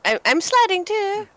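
excited squeal. Real, not acted. Sliding down the mountain.

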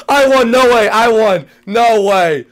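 loud disbelieving victory yells; shouting no way in delight; loud triumphant shouting. Real, not acted. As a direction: cheer it out. Celebrating the win.

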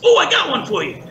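high, cranky cartoon grump; a sour joker setting up a gag; grumpy hydrant voice. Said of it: "a pissed off sounding guy".

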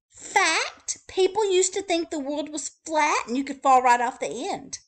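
high, eager rattling list; chatty bird voice. Acted. Wordy Birdie telling readers what she likes, high and eager. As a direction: gush through the list.

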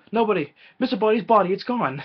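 high, loud flustered cry; mock panic. Guest panicking that the body vanished, mock alarm. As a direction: stammer in panic.

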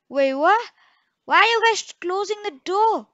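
high, loud panicked question; shocked at being locked in; alarmed shout. Real, not acted. Locked in by friends.

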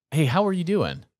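ordinary talk, nothing marked

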